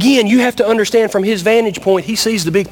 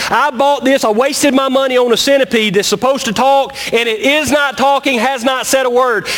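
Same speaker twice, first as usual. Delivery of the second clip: fed-up man ranting at his silent pet; frustrated owner; 6 semitones higher than usual. Acted. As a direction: gripe about the wasted money. Frustrated owner complains aloud.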